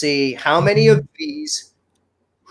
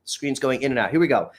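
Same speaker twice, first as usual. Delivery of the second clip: ordinary delivery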